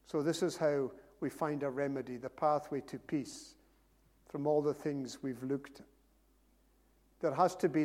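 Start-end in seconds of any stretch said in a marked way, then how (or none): none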